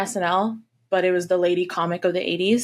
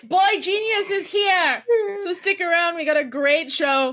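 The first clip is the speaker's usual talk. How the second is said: announcer hype; 10 semitones higher than usual. Pretend. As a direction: hype the crowd like a host. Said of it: "mock show announcement".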